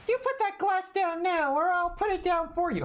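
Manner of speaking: a strict lady ordering someone to put the glass down; different character voice. Acted. Stern lady voice scolding a witness about a glass. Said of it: "I gave her a completely different voice there".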